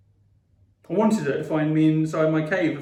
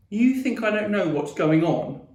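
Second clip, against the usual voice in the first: high, raised angry challenge; yelled; 6 semitones higher, 4 dB louder than usual. A narrator's reading. Boy pointing at his sister, yelling. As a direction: yell the question as a challenge. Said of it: "I yelled".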